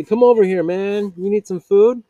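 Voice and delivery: loud, high call across the park. Retold or imitated. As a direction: mimic friendly bikers hollering him over. Bikers calling him over.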